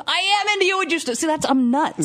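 high-pitched mock insistence; retold protest. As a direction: imitate her indignantly protesting. Retold fight, friend protests.

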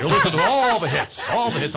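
lightly voiced radio DJ with a laugh; a hint of a hit-radio host. Kid in the trunk playing radio DJ, lightly voiced. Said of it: "my own D J voice".

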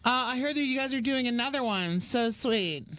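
high, sweet imitation of a girl; gushing girl fan. Girl asks about another one, imitated gushing. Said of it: "And she goes".

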